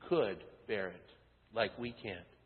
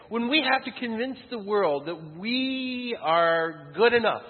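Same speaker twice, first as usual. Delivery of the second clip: higher and louder, slowing to a shout; preaching climax; 7 semitones higher, 6 dB louder than usual. Real, not acted. Pastor to congregation, building to a loud peak. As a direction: drive it home with full force.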